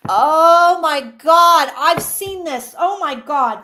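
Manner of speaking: very loud, drawn-out oh my God; overwhelmed amazement; stunned. Real, not acted. Psychic realising her vision came true, loud stunned cry.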